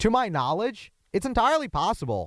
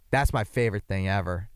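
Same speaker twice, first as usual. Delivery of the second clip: ordinary talk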